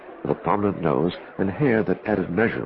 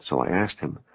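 ordinary delivery

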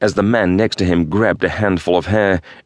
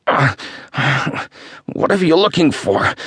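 high, strained voice in pain; 8 semitones higher than usual. Acted. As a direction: force the words out through pain. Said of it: "Zach struggled to answer through the pain".

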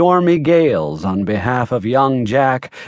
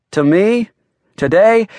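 "To me, today" each pitched high and loud; emphatic retort; 8 semitones higher, 4 dB louder than usual. A narrator's reading. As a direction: hit "me" and "today" separately, with a pause between. Grumpy sleeper insists on his point.

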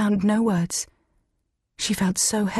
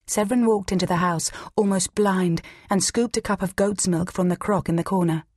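ordinary delivery, nothing marked

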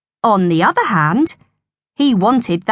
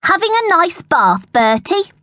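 high, mocking sing-out; jeering; 10 semitones higher than usual. A narrator's reading. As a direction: taunt a boy who just fell in. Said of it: "jeered a voice".

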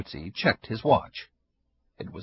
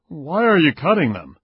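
gruff neighbor; 6 semitones higher, 8 dB louder than usual. Acted. Neighbor confronts Ida.

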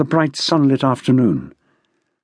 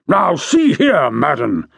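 indignant shout; slower than usual. A narrator's reading. General forcing himself back into the conversation, indignant. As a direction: force your way in, indignant. Ignored general angrily breaks in.

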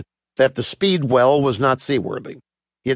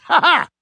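high, short villainous laugh; acted high-pitched "ha ha"; mocking laugh. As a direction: laugh at your own cruel joke. Sailor laughs at his own taunt.

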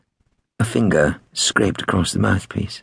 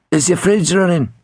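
high-pitched Liverpudlian caller; 10 semitones higher, 3 dB louder than usual. A narrator's reading. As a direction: quiz them in a high Liverpudlian accent. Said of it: "in his best Liverpudlian".

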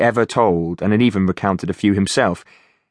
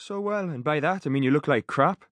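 cheeky Geordie banter; teasing Geordie mate; Geordie accent; 7 semitones higher, 9 dB quieter than usual. Acted. Finch teasing his mate.

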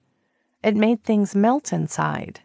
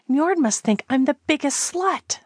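higher mortified groan; 8 semitones higher than usual. Acted. Callie groaning in shame about Njord watching. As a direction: groan it into the rock, embarrassed. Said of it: "she groaned".

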